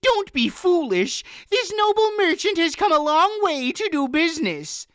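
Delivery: high, loud shrill snap; snapping daughter. A narrator's reading. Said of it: "Anhelda snapped".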